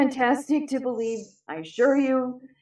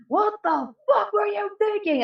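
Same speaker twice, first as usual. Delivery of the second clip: retold angry yell; 12 semitones higher than usual. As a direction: imitate a father yelling at his kid. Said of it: "And he yelled".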